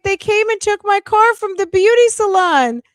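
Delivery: high, indignant Shirley voice; imitating Shirley. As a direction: squeal it like the upset wife. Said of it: "She's like".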